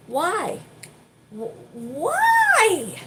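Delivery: high mock whine; mock baffled customer. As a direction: squeal the question in disbelief.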